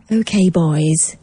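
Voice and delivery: slow, Scouse-tinged manager voice; Scouse Epstein impression. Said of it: "a bit more like that".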